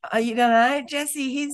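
challenging comeback. Real, not acted. Host challenging the doubting co-host, incredulous.

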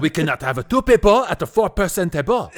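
high, loud French voice through laughter; mock French accent. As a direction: ham up a French manager. Mimicking French woman.

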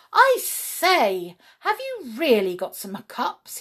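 a small excited brownie; huffy little helper elf. Acted. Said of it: "he said".

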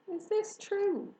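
slow, high, doubting question; suspicious. A narrator's reading. Rat doubting the egg sac news, suspicious. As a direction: squint at it in disbelief. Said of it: "eyeing the sack suspiciously".

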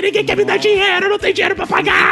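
hyperventilating. Retold or imitated. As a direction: gasp out a fake panic attack. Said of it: "crise de pânico". (crise de pânico, voz aguda e ofegante)

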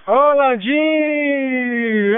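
slow, stretched call with reverb; echo effect. Real, not acted. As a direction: let the name ring out long and echoing. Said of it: "Tô parecendo aqueles macanudos... com aquele eco".

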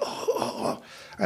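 huffing and puffing. Retold or imitated. Imitating injured friend, breathy pained huffs. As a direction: puff out each oh like a man hurting. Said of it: "like, huffing and puffing".

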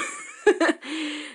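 amused laugh after the joke; real laugh. Real, not acted. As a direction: let a real laugh slip out. Laughing at own point.

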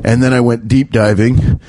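usual speaking voice

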